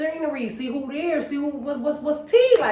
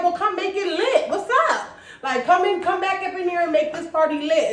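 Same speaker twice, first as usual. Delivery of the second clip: hyped coaxing; 6 semitones higher than usual. Retold or imitated. Hyping her back into party.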